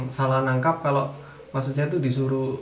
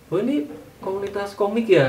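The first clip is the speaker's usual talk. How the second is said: higher-pitched imitation of someone asking; curious questioner; 6 semitones higher than usual. Someone asking about the comic community, lightly imitated curiosity. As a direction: lightly act out an outsider's question. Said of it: "pernah ditanya sama orang".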